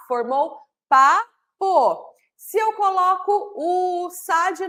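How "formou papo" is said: "papo" said slowly and a bit louder, pa-po; slow emphatic word. Shown as a demonstration. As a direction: present the new word "papo" slowly and clearly. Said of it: "Se eu tiro o sa e coloco o pá". ("papo" falado devagar e marcado)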